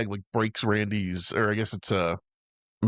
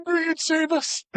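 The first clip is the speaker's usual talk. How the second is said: much higher, hammed-up breathless-fan voice; Gary swooning. Friend retelling Gary's line in a much higher, swooning put-on voice. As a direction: gush it like a starstruck rescued guy. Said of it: "He's like".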